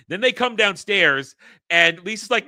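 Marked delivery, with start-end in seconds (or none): none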